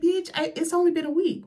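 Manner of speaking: high-pitched mock protest; indignant retort. Retort to removed movie.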